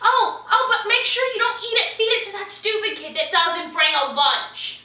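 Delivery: bossy mom. Acted. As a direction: imitate a mom barking lunch instructions. Mom voiced giving orders.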